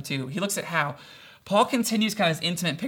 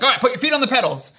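raised, rushed mock impatient command; frustrated ordering; 6 semitones higher, 5 dB louder, much faster than usual. Dad imitating himself teaching his toddler to pedal, frustrated. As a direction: order it louder, higher and fast, acting fed up. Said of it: "I'm, like, getting frustrated".

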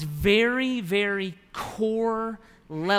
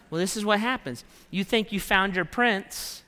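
ordinary talk, nothing marked

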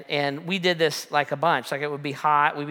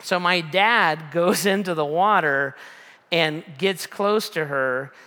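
normal talking voice

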